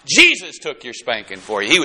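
normal talking voice

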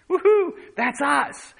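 high, loud joyful whoop; excited whoop. Real, not acted. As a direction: shout it out with joy. Preacher cheering over God's protection.

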